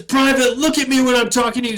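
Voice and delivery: fast, high, loud sergeant bark; drill sergeant yell. Retold or imitated. Drill sergeant ordering the private to look at him. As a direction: snap the order at the recruit.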